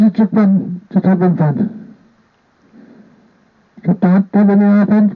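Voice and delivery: usual speaking voice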